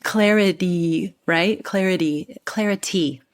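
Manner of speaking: "Clarity" spelled out slowly with a crisp T; slow teacher demo of "clarity". Shown as a demonstration. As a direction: tap the T cleanly in "clarity", like a teacher. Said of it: "have the T's sound like T's".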